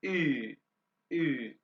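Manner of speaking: "ई" as rounded German ü, held long; U-umlaut sound. Shown as a demonstration. Teacher demonstrates U umlaut, rounded drawn-out "ई". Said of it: "और यू को".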